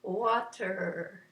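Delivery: long, flowing 'water'; floaty. Shown as a demonstration. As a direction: let the end of 'water' drift. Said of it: "it even sounds kind of floaty".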